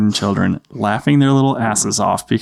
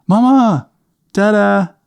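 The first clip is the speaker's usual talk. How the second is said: put-on very high little-boy call, falling at the end; Adam; 10 semitones higher, 3 dB louder, slower than usual. Little boy calling his parents a second time, high falling call. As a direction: call out insistently as a small child. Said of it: "He goes".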